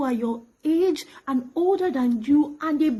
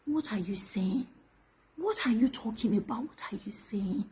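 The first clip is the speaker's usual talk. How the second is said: hushed, stunned whisper; 5 semitones lower, 9 dB quieter than usual. Real, not acted. To Timileyin, whispering her disbelief. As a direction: barely voice the words in disbelief.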